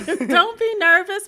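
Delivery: high, loud and slow through laughter; laughing. Real, not acted. Guest reassuring the nervous host, laughing. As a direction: laugh while you reassure her.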